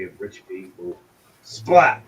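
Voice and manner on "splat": loud, sudden impact word; imitated splat. Game master telling the mace kill, punchy splat. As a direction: punch it out like a blow landing.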